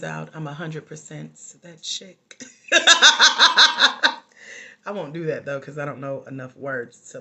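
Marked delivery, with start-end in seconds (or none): 2.5-4.8 big, high burst of laughter; loud laugh. Real, not acted. Coach joking about her DNA results, loud high laughing. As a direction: crack up loudly at your own joke.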